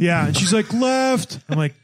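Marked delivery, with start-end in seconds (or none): 0.7-1.4 high, sing-out passing call; imitated call-out. Older woman overtakes rider, mock calling out. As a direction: call out as you overtake on a bike.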